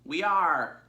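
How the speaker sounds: all the sheep agreeing at once; flock in chorus. Acted. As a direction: sheep chanting agreement together. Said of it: "chorused the flock".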